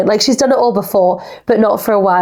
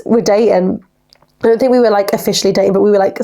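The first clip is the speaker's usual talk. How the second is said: ordinary delivery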